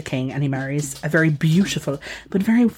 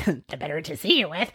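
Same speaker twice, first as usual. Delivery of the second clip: softer put-on wolf voice; 6 dB quieter than usual. Disguised wolf answering the girl, softly creepy. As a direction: lightly play the creepy wolf, a bit hushed. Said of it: "responds the wolf".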